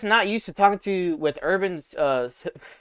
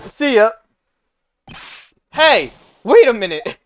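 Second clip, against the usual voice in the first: loud, higher brash guy voice; Larry voice; 4 semitones higher, 7 dB louder than usual. Acted. Larry bumping into old friends, loud and brash. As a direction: play it big and pushy.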